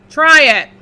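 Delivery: loud challenging taunt; defiant dare. Acted. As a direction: dare him to try. Red dares wolf.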